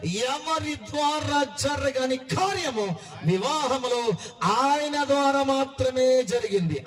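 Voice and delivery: high, sing-song sermon cadence; fervent Telugu pastor pleading with the congregation, sung preaching; impassioned preaching. Acted. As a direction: preach it out to the crowd, half chanting. Preacher listing the points.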